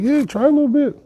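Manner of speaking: high-pitched, casual coaxing. Acted. Bull tempting the turkey to taste it, high coaxing voice. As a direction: pitch it way up to tempt someone playfully.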